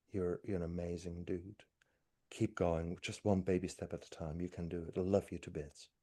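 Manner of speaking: hug yourself and speak gently; tender self-talk. Shown as a demonstration. Hugging himself, telling himself softly he is loved. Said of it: "And I say".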